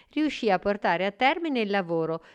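ordinary delivery, nothing marked

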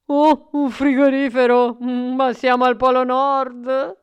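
put-on loud, amused old man; laughing exclamation. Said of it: "esclamò ridendo". (esclamando ridendo)

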